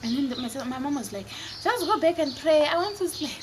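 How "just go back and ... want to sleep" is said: higher-pitched, mock-irritated mom; exasperated mom. Mom sends scared children back to bed, exasperated. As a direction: brush them off as a woken mother.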